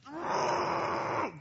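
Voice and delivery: long, high rasping yell; demonic scream. Retold or imitated. Woman manifesting a demon in church, roaring. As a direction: imitate a demon with a drawn-out roar. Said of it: "completely manifested demon".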